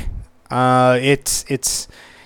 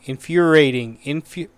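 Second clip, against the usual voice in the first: ordinary talk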